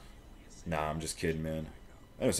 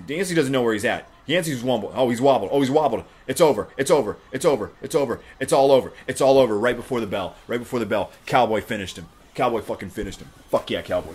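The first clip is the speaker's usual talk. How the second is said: loud, high, repeated punchy exclamations; excited knockout call; 4 semitones higher, 8 dB louder than usual. Real, not acted. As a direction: yell the finish as it happens. Calls the knockout live.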